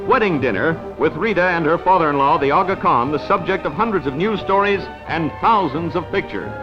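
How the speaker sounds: ordinary delivery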